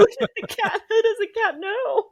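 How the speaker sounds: high, loud, helpless laughter through the words; laughing. Real, not acted. Co-host cracks up over the cat tweet, laughing. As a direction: squeal it out through real laughter.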